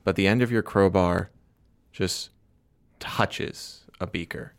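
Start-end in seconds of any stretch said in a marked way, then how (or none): 1.9-4.5 hushed suspense. A narrator's reading. Tense stealth moment in narration.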